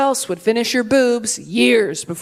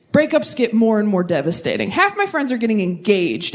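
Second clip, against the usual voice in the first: ordinary talk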